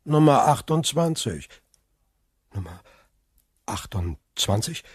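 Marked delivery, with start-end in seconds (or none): 2.5-4.8 stunned echo. Acted. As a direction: repeat the number in disbelief. Villefort echoes, stunned.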